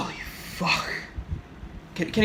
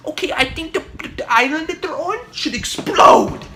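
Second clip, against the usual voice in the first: put-on much higher kid voice, bursting out loud; excited kid; 11 semitones higher, 6 dB louder than usual. Kid blurting his plot idea to Daddy, high and shouting. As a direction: blurt it out like a scared child.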